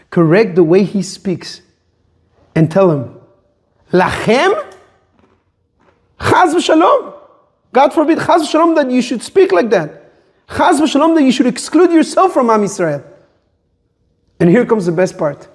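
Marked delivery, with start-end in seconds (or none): 3.9-13.0 high-pitched protesting God forbid; appalled exclamation, pitch lifted; shocked correction. Acted. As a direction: correct him with urgent, raised pitch. Acting out the correction to the son.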